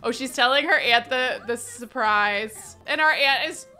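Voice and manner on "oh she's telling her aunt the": ordinary talk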